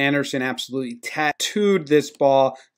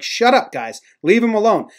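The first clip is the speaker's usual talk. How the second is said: higher-pitched mock manager's order; retold scolding; 7 semitones higher than usual. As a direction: imitate a manager hushing the players. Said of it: "Yost is like".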